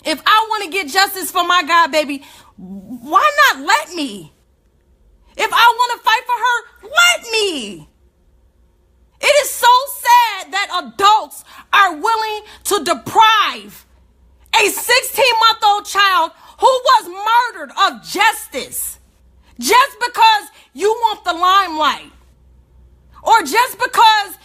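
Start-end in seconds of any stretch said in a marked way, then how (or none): 2.6-7.9 outburst. Real, not acted. Heated plea for justice. 9.2-22.1 high, loud indignant rant; angry. Real, not acted.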